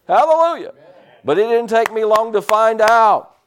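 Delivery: loud, high preacher's cry; full-force preaching. Acted. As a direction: preach it out to the congregation.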